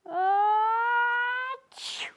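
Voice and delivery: high, loud long achoo; big sneeze. Acted. Sneezy sneezing hugely. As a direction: let it explode.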